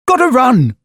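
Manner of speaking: high, loud hurried exit line; a quick loud excuse while running off; crying out. A narrator's reading. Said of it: "he cried".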